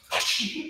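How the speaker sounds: "Hush" as a sharp, unvoiced "shh"; mock harsh shush. Shown as a demonstration. Director mocking a harsh hush for the choir. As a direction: snap a "Hush" like a scold. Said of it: "Quiet old lady isn't coming up going".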